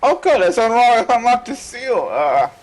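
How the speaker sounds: nervous, high and loud; scared horror game streamer. Real, not acted. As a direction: panicky stammer, backing away. Spooked by a horror game jump scare.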